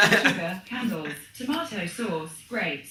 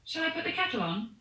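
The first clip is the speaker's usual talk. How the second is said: higher, fast cheery British offer; fast, high British tea offer; British in crisis; 8 semitones higher, faster than usual. Shown as a demonstration. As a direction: chirp it out like a British host in a crisis. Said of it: "in times of crisis, might say".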